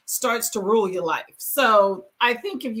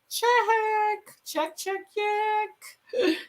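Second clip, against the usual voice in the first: playful check-off; 10 semitones higher, much slower than usual. Real, not acted. Ticking off a list of teeth.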